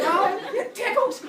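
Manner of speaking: high, slow, silly voice; mock ticklish squeal. Bully whipped by spaghetti arms, high mock ticklish voice. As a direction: squeal it as if barely touched.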